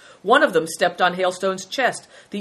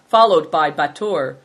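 ordinary delivery

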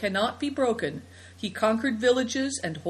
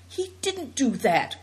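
excited denial; 6 semitones higher than usual. A narrator's reading. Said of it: "cried Seven Stars excitedly".

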